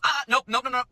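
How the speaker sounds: high, quick refusals; fussy imitated interruption; mock dismissive customer. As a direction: imitate a diner halting the server. Customer halting server, retold.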